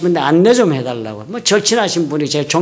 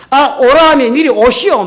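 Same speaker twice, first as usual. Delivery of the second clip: higher, louder retold scolding command; scolding bark; 6 semitones higher, 5 dB louder than usual. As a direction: imitate a pushy man ordering you over. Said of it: "호통을 치는 거예요".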